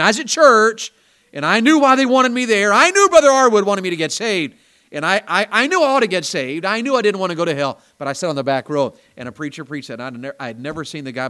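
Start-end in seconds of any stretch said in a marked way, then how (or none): 0.0-7.8 louder, higher preaching climax; ringing pulpit delivery; full-force preaching. Real, not acted. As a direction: preach it out to the back row.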